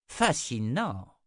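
drawn out, savoring the word; fascinated and slow; slow intrigued murmur. Acted. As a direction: sister intrigued by Jensen, slow and curious. Sister studies Jensen.

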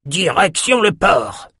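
high, slow called-out order; rally them toward the harbour; high, slow shout; shouted command. A narrator's reading. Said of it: "cria Lauren".

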